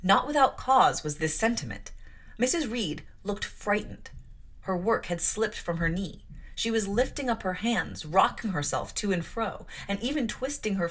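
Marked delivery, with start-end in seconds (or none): none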